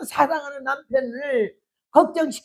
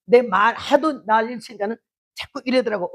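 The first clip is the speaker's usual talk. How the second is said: usual speaking voice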